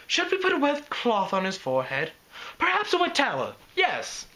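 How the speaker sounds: high, elegant lady voice; posh Rarity voice. Acted. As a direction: purr it like a grand lady.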